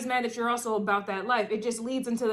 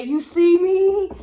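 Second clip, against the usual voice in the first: slow, high, loud hype call; John Cena; 9 semitones higher, 14 dB louder, much slower than usual. Retold or imitated. Repeats the wrestler's catchphrase to the viewer, slow and loud. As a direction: stretch it out like a ring entrance. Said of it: "John Cena vibes".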